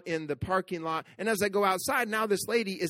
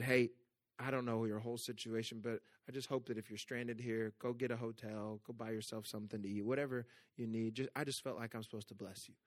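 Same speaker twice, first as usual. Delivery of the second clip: much softer, gentle reassuring words; soft encouragement; 9 semitones lower, 15 dB quieter than usual. Retold or imitated. Pastor telling the crying mother that God loves her, soft and tender. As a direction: quietly comfort a stranger in distress. Offering money to stranded mother.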